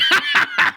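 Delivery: high, loud insane laugh; maniacal cackle. Acted. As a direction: cackle like a villain. Mad narrator cackles.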